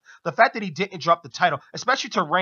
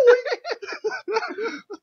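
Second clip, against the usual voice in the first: high laugh. Real, not acted. Laughing at the bit.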